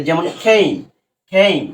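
"খেইম" with A as its letter name "ei"; long A sound. Shown as a demonstration. Teacher showing pupils the VCV rule, long A in "খেইম". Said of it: "এই এর মতনই উচ্চারণ হয়েছে".

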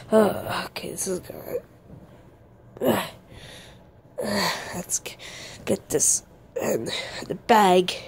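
hushed sneaky whisper. Pretend. Sneaking into the machine.

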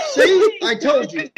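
very high, squealed insistence; frantic vindication. Acted. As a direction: shriek it as proof at last. Frantic vindication about the doll.

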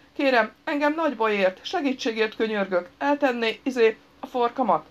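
pleading. A narrator's reading. Injured man begging the angry stranger, pleading.

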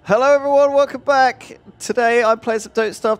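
high, loud channel intro; bright host greeting to viewers; YouTuber intro. Acted. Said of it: "redo the intro".

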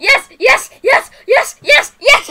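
excited yeses. Real, not acted. Thrilled about the swing, rising excited yeses. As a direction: cheer each yes upward.

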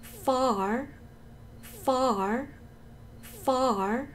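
FAR drawn out, "ah" before the r; stretched model of FAR; long vowel, late r. Shown as a demonstration. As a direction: drill FAR slowly with a late-curled r. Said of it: "중요한 거는 이 모음 길이예요".

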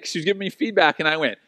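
ordinary talk

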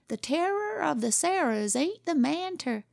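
put-on "the terror of the Sierras" high, swaggering, boyish outlaw brag; boy playing outlaw. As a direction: boast "the terror of the Sierras" like a kid gunslinger. Boy plays Western outlaw.

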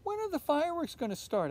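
high, fast impatient question; eager child asking impatiently; eager girl. A narrator's reading. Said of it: "asked Olivia".

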